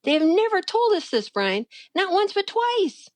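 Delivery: high-pitched, emphatic retold pleading; dramatic insistence. Wife urging her husband to heed the warnings, high dramatic insistence. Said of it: "you're being so dramatic".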